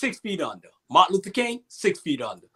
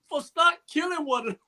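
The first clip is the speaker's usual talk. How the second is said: high, tearful voice, a bit softer now; still crying; 10 semitones higher, 3 dB quieter, faster than usual. Real, not acted. Repeating the boys' plea again, still crying. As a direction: push the words through the tears. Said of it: "I'm about to get emotional".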